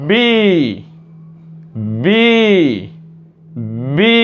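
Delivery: বি with a long i; model pronunciation. Shown as a demonstration. Teacher models the next letter for learners, long emphatic বি. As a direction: say বি separately, drawn out and emphatic. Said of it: "আমরা যেটা দেবো উচ্চারণ করব".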